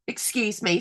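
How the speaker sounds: normal talking voice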